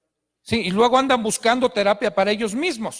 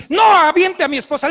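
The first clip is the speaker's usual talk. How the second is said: loud, high outburst; acted-out irate husband; angry protest; 7 semitones higher, 8 dB louder than usual. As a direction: burst in shouting at someone. Stepfather's angry outburst retold.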